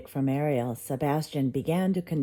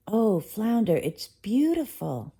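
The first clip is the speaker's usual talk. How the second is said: delighted gasp; 6 semitones higher than usual. A narrator's reading. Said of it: "Ariel gasped with delight".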